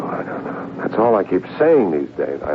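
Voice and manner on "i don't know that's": a breathless, hushed I don't know; barely voiced, sinking into worry; whispered despair. Agent confessing his helplessness to Ivan, whispered.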